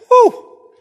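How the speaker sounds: high, loud mock sigh of relief; hammed-up sigh of relief; relieved whew. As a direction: blow out a big whew like a narrow escape. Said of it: "I'm guessing Amos is like".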